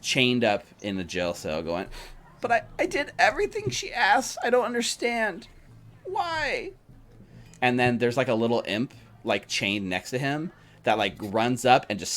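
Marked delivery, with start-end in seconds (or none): 2.4-6.7 very high, whiny put-on voice; Thavius Krieg. A narrator's reading. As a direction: whine pitifully in a squeaky, far higher pitch. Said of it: "the unmistakable whiny voice of Thavius".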